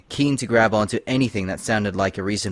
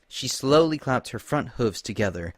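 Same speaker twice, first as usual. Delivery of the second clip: usual speaking voice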